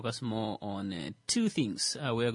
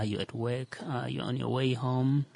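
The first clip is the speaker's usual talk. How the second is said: ordinary talk, nothing marked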